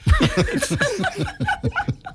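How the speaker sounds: long rising laugh; high laugh. Real, not acted. Laughs at homonym joke.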